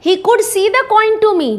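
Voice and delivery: क्रोधित भिखारी की तरह ऊँची आवाज़ में शिकायत. Acted. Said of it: "उसको बहुत गुस्सा आ गया". (गुस्से में ऊँची आवाज़)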